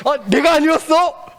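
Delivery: high, slow, shocked gasp of a question; flustered surprise. Acted. Haman realising the honor is not his, stunned surprise. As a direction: let the shock land as the honor slips away. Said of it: "하고 당황해가지고".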